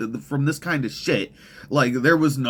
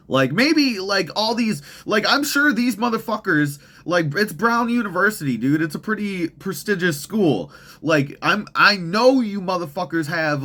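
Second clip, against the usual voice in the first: heated tirade; 6 semitones higher than usual. Real, not acted. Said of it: "I went a little long on that tirade".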